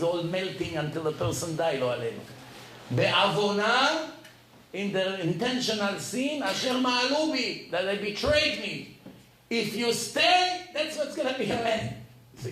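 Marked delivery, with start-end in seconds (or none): 2.9-4.1 slow, louder and higher; solemn intoning. Acted. Intones the Hebrew word. 9.5-11.9 ominous warning. Acted. Repeats the dire warning.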